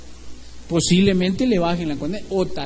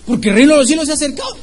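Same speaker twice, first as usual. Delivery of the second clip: loud, rapid, higher-pitched answer; emphatic retort; 6 semitones higher, 7 dB louder, much faster than usual. Acted. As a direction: rattle it off forcefully as the preacher's reply. Baptist's hurried retort to objector.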